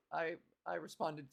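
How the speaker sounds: ordinary talk, nothing marked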